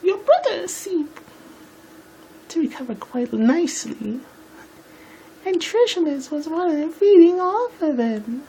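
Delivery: very high falsetto lady voice; hammed-up, badly faked high voice; high-pitched Rarity. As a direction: squeak it out as a dainty pony. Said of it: "And this is Rarity".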